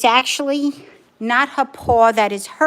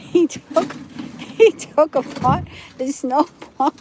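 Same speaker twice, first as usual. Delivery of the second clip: higher voice, talking through laughter; real laugh breaking into the words; 4 semitones higher than usual. Real, not acted. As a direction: tell it while cracking up. Laughing about the snowball.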